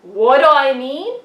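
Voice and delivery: loud, rising, outraged repeat; indignant outburst. Acted. Sheep puppet fires back at the pastor, loud and indignant. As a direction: throw the question back in disbelief.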